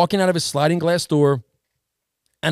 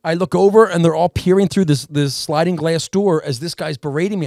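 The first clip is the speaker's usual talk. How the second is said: normal talking voice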